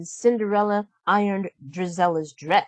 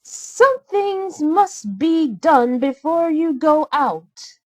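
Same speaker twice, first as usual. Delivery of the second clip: higher, louder, slow commanding voice; bossy stepsister; 9 semitones higher, 4 dB louder, slower than usual. A narrator's reading. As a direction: lay down the order slowly. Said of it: "said Anastasia".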